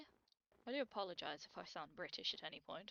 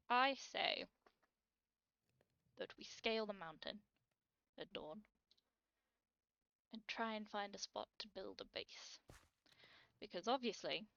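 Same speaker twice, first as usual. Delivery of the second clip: ordinary talk, nothing marked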